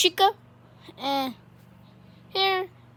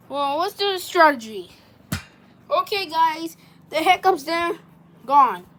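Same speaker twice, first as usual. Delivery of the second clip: ordinary talk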